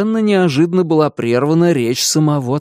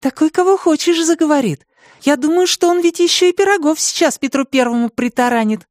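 put-on girl's voice; 13 semitones higher than usual. A narrator's reading. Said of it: "Анька".

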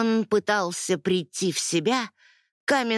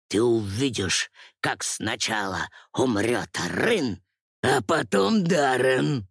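menacing villain; 9 semitones lower than usual. Acted. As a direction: gloat like a cruel tyrant. Villain Amon's threatening speech.

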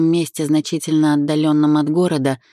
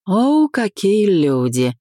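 higher, slow drawn-out exclamation; drawled greeting; 6 semitones higher, 3 dB louder, slower than usual. A narrator's reading. Romka greets a caller he thought forgot him, drawling. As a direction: stretch out a teasing hello. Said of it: "протянул Ромка".

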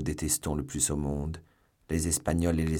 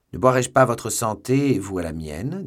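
loud, high, jovial offer; expansive, convivial raised voice; hearty toast; 5 semitones higher, 8 dB louder than usual. A narrator's reading. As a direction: propose a drink with gusto. Hearty toast to the guards' chief.

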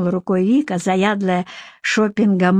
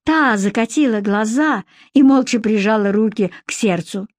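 plain reading voice

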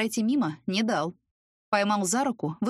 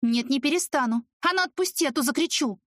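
sharp rising threat to scream for help; raised voice; 7 semitones higher than usual. A narrator's reading. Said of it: "В самом деле повысила голос".

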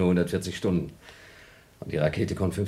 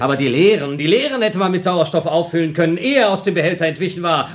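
rising, louder, higher accusation; angry reproach; 10 semitones higher, 7 dB louder than usual. Acted. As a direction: build up blaming him. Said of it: "Beruhige dich".

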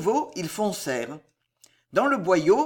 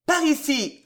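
raised, higher-pitched summons; calling out; 7 semitones higher, 3 dB louder than usual. A narrator's reading. Ruggieri to the looters outside, calling out. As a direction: call people over from a distance. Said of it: "appela Ruggieri".

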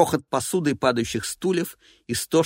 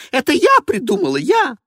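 high, loud and possessive; insisting it was her idea; shrill diva voice; 14 semitones higher, 8 dB louder than usual. Acted. Evelina claiming.

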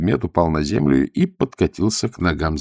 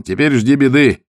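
louder, higher cry; shouted warning; 7 semitones higher, 6 dB louder than usual. A narrator's reading. Zagorsky warns of trouble after the shot, shouting. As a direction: call out a warning to the others. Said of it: "крикнул он".

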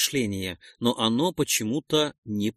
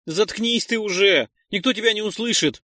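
higher, fast irritated snap; snapping; 7 semitones higher, 4 dB louder than usual. Acted. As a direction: cut him off sharply. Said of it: "огрызнулся".

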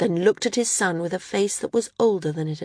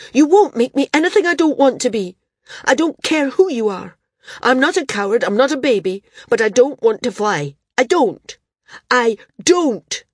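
loud, high and fast, all but screaming; a son defying his father, almost screaming; near-screaming; 6 semitones higher, 7 dB louder than usual. A narrator's reading. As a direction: pour it out in a desperate rush. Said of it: "all but screamed into the wind".

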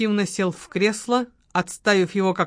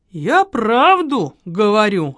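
snap; 3 semitones higher, 6 dB louder, slower than usual. A narrator's reading. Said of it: "огрызнулся её любовник".

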